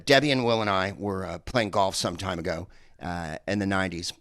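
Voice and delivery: ordinary talk, nothing marked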